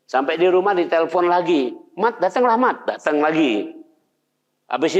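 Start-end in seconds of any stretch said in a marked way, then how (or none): 1.9-2.8 raised, high call to come over; neighbour calling. Retold or imitated. Neighbour phoning Imam Ahmad, calling him over, pitched high.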